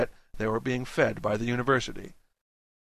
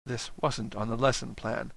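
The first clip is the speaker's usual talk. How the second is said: plain reading voice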